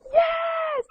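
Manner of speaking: very high, gleeful squeal; acted-out overjoyed cry; so excited. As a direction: cheer it in delight. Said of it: "he'd be, like, so excited".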